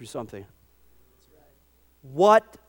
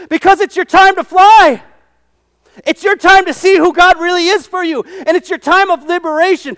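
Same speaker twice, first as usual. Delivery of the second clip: loud, high preaching at full force; 11 semitones higher, 12 dB louder than usual. Acted. Preacher exhorting the congregation about faith, fervent.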